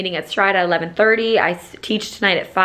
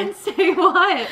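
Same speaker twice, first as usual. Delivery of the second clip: high playful question; 10 semitones higher, much slower than usual. Real, not acted. Asking friend what to say.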